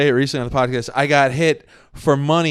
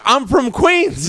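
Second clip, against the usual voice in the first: slow, drawn-out Queens drawl; thick Queens accent; 8 semitones higher, much slower than usual. Retold or imitated. Friend mimicking a Queens guy, slow thick Queens accent. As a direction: lean hard into a Queens accent. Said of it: "It's just how I talk".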